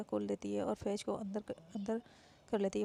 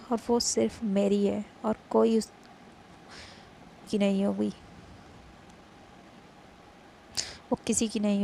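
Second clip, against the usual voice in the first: loud, firm claim on her love; possessive retort; 11 dB louder than usual. Acted. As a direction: stand your ground, loud. Faiz answers the rival.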